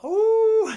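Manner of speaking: loud, high wolf howl; one long, high, held howl; imitated howl. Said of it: "he just howled".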